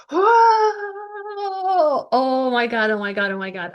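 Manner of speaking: amazed crowd reaction, high whoa; picture a stunned audience; imagined crowd gasp. Pretend. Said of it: "applause is in the back of my mind".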